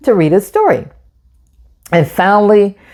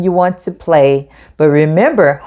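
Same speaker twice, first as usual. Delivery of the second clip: ordinary delivery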